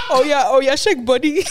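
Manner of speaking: put-on loud, high African accent. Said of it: "an African version".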